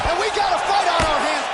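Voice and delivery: high, loud hype call; excited shout. Real, not acted. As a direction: hype up the crowd. Fight heats up.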